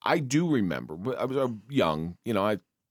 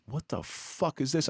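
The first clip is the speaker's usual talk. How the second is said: slow, softer, shaken question; retold bewilderment; 4 semitones higher, 5 dB quieter than usual. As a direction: ask it slowly, stunned at your own shaking. Retold shaking after a tense call.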